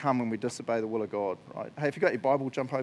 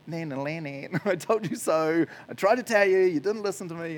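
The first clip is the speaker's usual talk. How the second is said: mocking taunt; 3 semitones higher, 3 dB louder than usual. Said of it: "does a giant".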